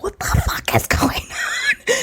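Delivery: high, drawn-out whispery wail; hammed-up near-crying, slow and squeaky; mock tearful disbelief. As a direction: whimper it in exaggerated dismay, stretched out. Her reaction as everyone gets emotional.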